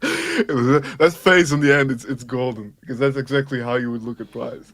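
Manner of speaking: put-on thick Germanic-accented action hero voice; action-star impression. Co-host talking crypto on stream, action-star impression.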